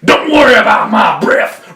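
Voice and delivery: loud, high furious bellow; roaring wolf. A narrator's reading. Wolf fed up with the child's insults, roaring. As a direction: snap out of disguise into a roar. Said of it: "roared the wolf".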